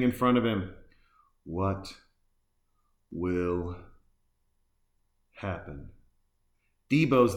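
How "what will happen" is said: slow, long pauses between "What", "will" and "happen", dropping quieter and lower; dramatic teaser. Acted. Host teasing what the warden will face, slow hushed suspense. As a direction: build suspense word by word, fading to a hush.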